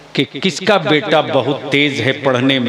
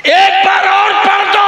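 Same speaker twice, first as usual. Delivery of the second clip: higher and louder, begging to hear it again; urgent shouted plea; 4 dB louder than usual. Retold or imitated. Farooq Azam begging to hear the verse, shouted. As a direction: shout the request out in awe. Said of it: "Farooq आजम आगे बढ़ के कहते".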